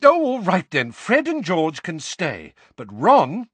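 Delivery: high, loud, exasperated mother; flustered surrender. A narrator's reading. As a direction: give in crossly, breaking off at first.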